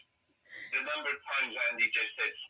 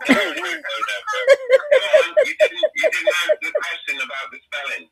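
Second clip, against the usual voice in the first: louder, higher, laughing objection; loud laughing protest; 13 dB louder than usual. Real, not acted. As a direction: protest loudly through laughter.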